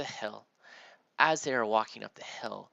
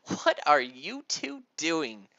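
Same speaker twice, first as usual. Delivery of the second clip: stern mom; 4 semitones higher, 7 dB louder, slower than usual. Acted. Hands on hips, mother.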